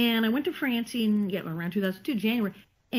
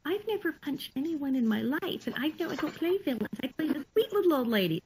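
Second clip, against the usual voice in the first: put-on higher, softer, demure old-lady voice; prim little old lady; 5 semitones higher, 4 dB quieter than usual. Actress protesting she only plays sweet old ladies, demure and soft. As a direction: play the sweet granny refusing to punch. Actress refusing villain role, retold.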